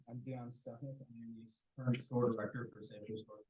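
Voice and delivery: faint, garbled bad line; radio breaking up. Real, not acted. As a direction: voice cutting out on the call. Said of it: "you're breaking up again".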